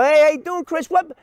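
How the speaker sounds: high, loud, surprised hello; answering the friend's sudden call, high and surprised; surprised greeting. Retold or imitated. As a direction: act thrown by the unexpected call. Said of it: "I go".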